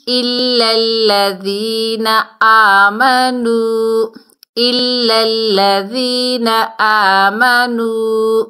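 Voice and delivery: slow, drawn-out Quranic recitation; tajweed recitation. Shown as a demonstration. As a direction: chant each word slowly like in tilawat. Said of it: "Quran recitation demo". (آہستہ تجوید کے ساتھ تلاوت)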